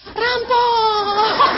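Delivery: high panicked yell; frightened scream of "Rampok!"; screaming thief. Retold or imitated. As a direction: shout it like you saw robbers. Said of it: "saya jadi teriak-teriak".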